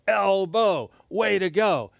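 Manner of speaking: put-on upbeat playful cheer for kids. Shown as a demonstration. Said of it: "We gotta go".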